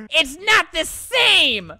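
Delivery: high, loud, drawn-out whine; hammed-up tantrum protest; mock-distraught wail. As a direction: wail it over a petty tragedy. Acted whining over broken toothbrush.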